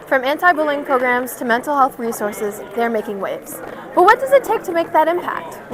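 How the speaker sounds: ordinary talk, nothing marked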